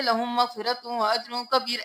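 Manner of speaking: quick, clipped لَهُمْ مَغْفِرَةٌ without ghunna; rushed wrong reading. Shown as a demonstration. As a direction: race through it carelessly. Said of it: "ایسے نہیں پڑھ سکتے". (جلدی جلدی غلط تلاوت)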